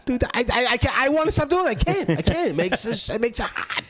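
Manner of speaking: high-pitched, giddy mock boasting; hammed-up high voice; imitated bragging comedian. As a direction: play a hack comic amazed at his own success.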